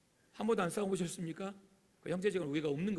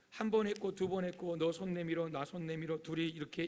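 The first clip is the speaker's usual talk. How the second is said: normal talking voice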